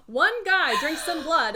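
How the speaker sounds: loud, rising disbelief breaking into laughter; mock incredulous. Real, not acted. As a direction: scoff loudly at the absurd logic. Mocking the vampire stereotype, laughing.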